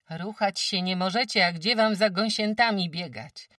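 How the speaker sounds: a brisk countrywoman sizing up a sick guest; sturdy farmwife. Acted. Farmwife Kłębowa tells old Agata she's too weak, brisk.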